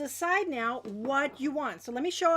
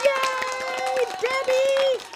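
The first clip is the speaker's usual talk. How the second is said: high, loud, drawn-out cheer; celebratory cheer; 9 semitones higher, 7 dB louder, much slower than usual. Real, not acted. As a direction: whoop the winner's name. Cheering third winner.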